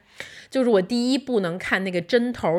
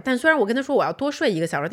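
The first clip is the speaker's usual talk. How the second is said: ordinary talk, nothing marked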